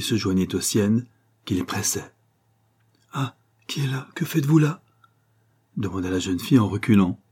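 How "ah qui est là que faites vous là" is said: higher, understated startled question; a touch of alarm as you challenge a stranger; startled fright. A narrator's reading. Young woman surprised by hands in the lilacs, mildly frightened. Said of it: "poussa un cri de frayeur".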